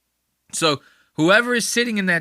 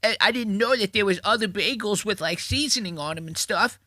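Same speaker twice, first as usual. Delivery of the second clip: Ed Norton bagel; 5 semitones higher than usual. Retold or imitated. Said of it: "He's doing the Ed Norton from Sausage Party".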